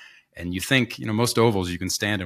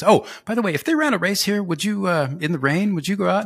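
imitating Marshall; 9 semitones higher than usual. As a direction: sing it up high like a sneaky aside. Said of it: "He's like".

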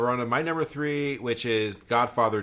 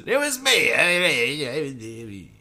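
loud, drawn-out Italian caricature, higher; mock Italian accent; 5 semitones higher, 6 dB louder, much slower than usual. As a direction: stretch it out in a hammed-up Italian accent. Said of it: "racist Italian accent".

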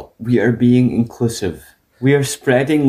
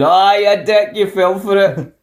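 gloating prankster; 9 semitones higher, slower than usual. Retold or imitated.

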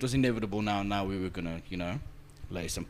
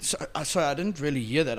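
ordinary talk

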